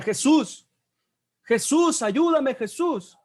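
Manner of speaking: high, urgent imitated call for help; acted shout. Blind man calls to passing Jesus, imitated shouting. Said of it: "Y entonces comienza a gritar".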